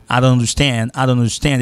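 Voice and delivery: "I don't understand" starting on "ah", T silent; reduced I. Shown as a demonstration. Teacher modelling conversation, "I don't understand" in reduced accent form. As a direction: run "I don't understand" together as "ah-dan-understand". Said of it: "il pas sorti I tout".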